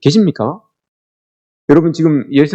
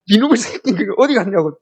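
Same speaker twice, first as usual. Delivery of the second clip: higher-pitched exasperated scolding; mock parental scolding; 6 semitones higher than usual. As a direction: scold like a fed-up parent, half in jest. Acted parent scolding a found son.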